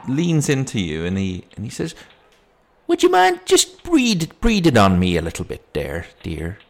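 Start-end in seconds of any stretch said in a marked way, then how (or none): 2.9-6.6 put-on copper voice testing for drink; suspicious policeman. Policeman suspects she is drunk.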